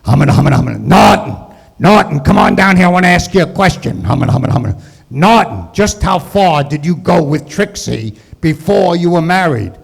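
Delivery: loud, blustering sitcom husband; Ralph Kramden. Acted. Husband summoning neighbor with a question, loud blustering impression. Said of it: "he liked my Jackie Gleason, Ralph Cramden impression".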